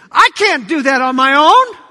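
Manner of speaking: loud protest rising at the end; protesting excuse. Acted. Moses makes excuses to God, loud rising protest. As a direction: balk at the task, voice climbing.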